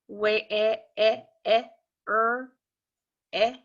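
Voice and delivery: "eh" and "er" sounds tried out one at a time; sounding out. Shown as a demonstration. Teacher testing the vowel sound aloud for the class. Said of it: "No, I'm not gonna hear".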